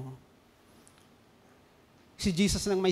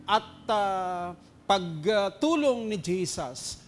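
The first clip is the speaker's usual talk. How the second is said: ordinary talk, nothing marked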